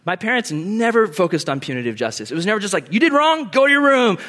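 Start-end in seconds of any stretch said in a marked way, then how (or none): 2.9-4.2 loud, high parental order; mock angry scolding. Punitive parent sending child to room, loud mock scolding. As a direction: send the kid off, raised voice.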